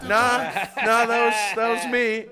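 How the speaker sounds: high, loud breezy boast; acted bravado over embarrassment; mock-proud front. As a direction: own up loudly, pitched high. Bragging front while secretly embarrassed.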